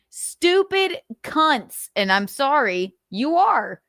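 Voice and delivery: usual speaking voice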